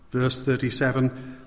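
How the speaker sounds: normal talking voice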